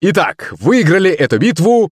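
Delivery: high-pitched stage announcement; triumphant showman shouting out the winners; excited host's cry. A narrator's reading. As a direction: proclaim the result to the whole hall. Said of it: "закричал ведущий".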